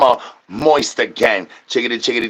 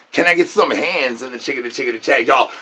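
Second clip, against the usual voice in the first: normal talking voice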